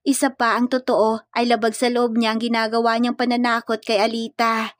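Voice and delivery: ordinary delivery, nothing marked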